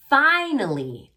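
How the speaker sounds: slow, drawn-out reading; drawn out like a read-along; slow read-aloud. Shown as a demonstration. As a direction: model it slowly for the class.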